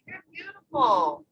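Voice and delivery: put-on high, slow little girl compliment. Little girl stops to compliment them, high and slow. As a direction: say it sweetly high like a small child. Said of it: "she was just like".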